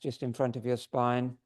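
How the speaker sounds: usual speaking voice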